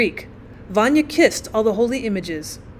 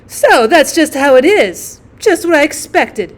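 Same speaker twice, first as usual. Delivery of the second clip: louder, higher, emphatic aunt; knowing aunt; 5 semitones higher, 8 dB louder than usual. Acted. Aunt walks in on the bad news, grimly knowing. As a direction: pronounce it as a doom foreseen.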